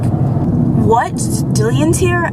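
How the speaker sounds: retold disbelieving question; surprised friend. Friend reacting to unexpected news.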